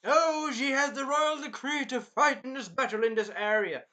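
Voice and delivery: a hint of a smug royal brat; a touch of evil narrator princess; snooty princess. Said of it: "The Red Princess bitch was like".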